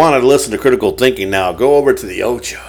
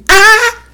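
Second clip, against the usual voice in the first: very high, loud cry; high yell; 7 dB louder than usual. Pretend. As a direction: belt a sudden high shout. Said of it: "Is that too high?".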